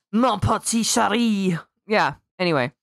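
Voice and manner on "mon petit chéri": slow, hammed-up French lover voice; mock French accent. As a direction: purr it like a cartoon Frenchman. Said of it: "some French, French guy popping out".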